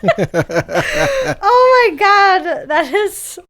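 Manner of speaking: high, loud, slow laughing gasp; laughing hard. Real, not acted. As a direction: barely get it out for laughing. Cracking up at the pun.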